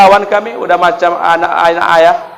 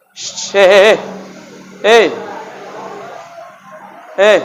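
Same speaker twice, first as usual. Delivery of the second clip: shush, then short calls of "hey"; hushing for attention; 3 semitones higher, 3 dB quieter, slower than usual. Real, not acted. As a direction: call the listeners to order.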